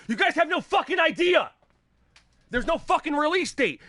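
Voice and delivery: high-pitched, exasperated yell; angry shout. Real, not acted. Streamer scolding viewers hyped for God of War, angry. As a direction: let them have it, really incensed.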